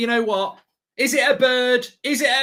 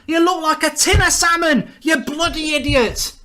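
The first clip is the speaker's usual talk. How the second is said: ordinary delivery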